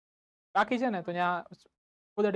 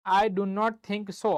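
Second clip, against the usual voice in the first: very slow, spaced-out teacher repeat; slowly, so the class can write; slow dictation; much slower than usual. Shown as a demonstration. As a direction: dictate it word by word. Dictating the English translation to class.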